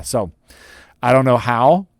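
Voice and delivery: normal talking voice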